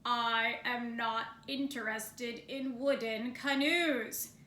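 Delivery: slow, gruff and loud bear grumble. Acted. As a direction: drag it out like an irritated bear. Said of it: "Bear's voice was even louder".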